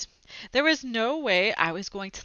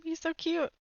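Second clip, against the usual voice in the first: put-on higher, soft, adoring kid voice; a kid gushing over the tiny creature, higher and adoring; 8 semitones higher, 4 dB quieter than usual. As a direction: coo over the little creature.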